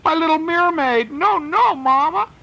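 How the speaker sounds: put-on high, loud child's scream; storyteller shrieking as a little girl. A narrator's reading. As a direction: wail like a small girl losing her teddy. Little girl protests loss of toys.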